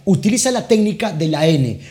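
ordinary talk

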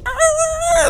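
high imitated "ah" noise; imitated peel-out noise. Other driver peeling off at the green light, high imitated noise. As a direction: mimic the other guy taking off. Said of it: "and he goes".

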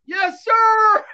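high, loud, strained yell; recruit's shout. Retold or imitated. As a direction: shout it back, scared and rigid. Recruit shouting reply at attention.

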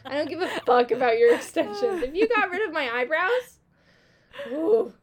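fake-furious, half laughing at a friend; mock outrage. Teasing a friend about her eyebrows, mock-outraged and loud.

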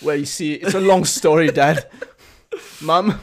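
wobbly, mock-Australian son; bad Australian accent. Son explaining divorce to parents, bad Australian accent. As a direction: ham up a poor Australian accent. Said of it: "bad Australian accent that turned into not".

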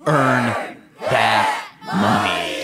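drawn-out shout of the title; stretched, shouted sign-off; shouted show title. Pretend. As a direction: call it out slowly like a show slogan. Announcing the episode's title together.

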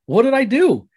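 high, loud squawked question; loud, high-pitched mock bafflement; mock-indignant protest. Retells own reply to insult.

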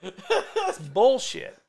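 cracking up mid-sentence; laughing. Real, not acted. Laughing at his own joke about lying.

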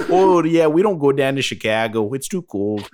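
a lightly done Minnesota twang; mock Minnesota accent. Host joking about Midwest speech, mock Minnesota accent. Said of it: "That's like North Dakota and, like, Minnesota".

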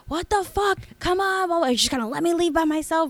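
mock her screeching at Bud; shrill drunk wife. Bartender imitating the drunk wife yelling at her husband. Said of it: "She was like".

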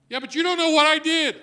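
loud, high protesting cry; a listener protesting loudly to the preacher; objector's outburst. Pretend. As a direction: blurt it out defensively.

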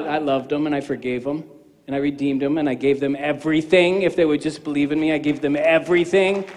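Jesus tenderly telling the believer what he did for them. Acted. Voicing Jesus in dialogue.

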